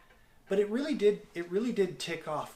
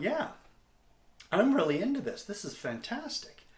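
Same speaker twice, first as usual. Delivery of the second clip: thrilled, higher and louder; excited inner voice; 8 semitones higher, 5 dB louder than usual. Retold or imitated. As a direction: burst out with delight at the idea. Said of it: "I thought".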